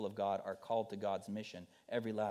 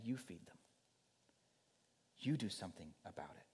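much softer, calm and sure; gentle voice of Jesus; 3 semitones lower, 10 dB quieter than usual. Acted. Jesus calmly telling his disciples to feed them, softly. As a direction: hand them the task quietly. The Savior's voice to listeners.

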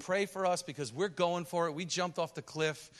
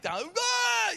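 high, loud exasperated cry; mock irritation; mock angry outburst; 8 dB louder than usual. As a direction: bark it like an annoyed boss. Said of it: "He's not like".

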